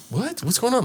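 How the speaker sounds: soft, high confused murmur; puzzled onlookers. Retold or imitated. Party guests wondering about the scene, confused.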